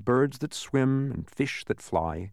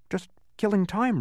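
apologetic; 8 semitones higher than usual. A narrator's reading. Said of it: "replied Milo apologetically".

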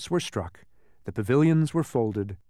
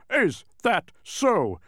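high, slow challenging retort; fainter retort; 7 semitones higher, much slower than usual. A narrator's reading. Mathematician answers the king's challenge, fainter retort. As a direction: draw it out as a distant retort. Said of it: "replied the mathematician a little more faintly".

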